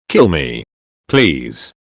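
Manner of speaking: low, faint begging, trailing off; a beaten man's lightly voiced plea to be killed. A narrator's reading. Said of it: "he begged".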